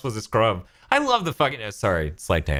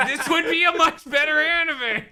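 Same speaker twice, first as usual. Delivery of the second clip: laughing, pitched much higher. Real, not acted. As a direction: giggle through the boast. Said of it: "I really should voice this guy".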